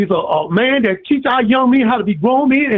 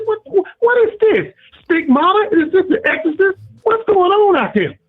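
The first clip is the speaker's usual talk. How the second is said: retold outburst; 8 semitones higher than usual. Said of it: "I, I was so angry".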